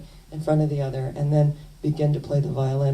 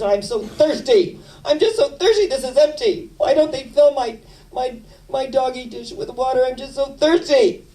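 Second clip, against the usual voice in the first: much higher, louder, whiny laughing dog voice; high squeaky pleading pup; whiny Snoopy; 8 semitones higher, 5 dB louder than usual. Retold or imitated. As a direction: whine like a parched cartoon dog.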